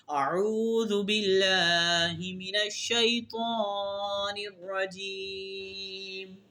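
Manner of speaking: slow, high chanted recitation; قرآن کی تلاوت کا انداز; Quranic recitation. Acted. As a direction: intone it slowly like tilawat.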